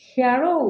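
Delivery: "hero" as hea-ro, rising; correct pronunciation. Shown as a demonstration. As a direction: glide the first vowel of hero, hea-ro. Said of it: "correct pronunciation হবে". ("hero" শুদ্ধ হিয়া-রো উচ্চারণ)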